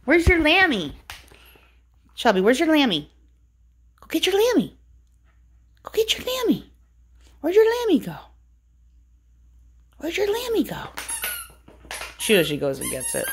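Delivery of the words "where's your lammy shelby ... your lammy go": high sing-song voice for the dog; talking to your dog, way up high; high pet talk. Real, not acted. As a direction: coax the dog in a raised, lilting pitch. Owner coaxing her dog.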